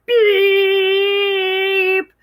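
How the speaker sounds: loud long honking beep like a tractor horn. Acted. Reading the tractor scene, loud horn beep. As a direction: blast it out like a horn.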